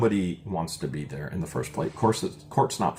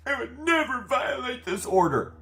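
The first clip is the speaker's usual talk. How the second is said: fake crying. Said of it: "She literally says".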